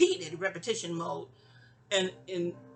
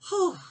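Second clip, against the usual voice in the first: relieved whew; 10 semitones higher, 4 dB louder than usual. Real, not acted.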